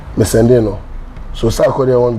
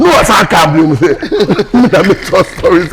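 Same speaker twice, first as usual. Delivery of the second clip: laughing; 5 semitones higher than usual. Real, not acted. Laughing while protesting.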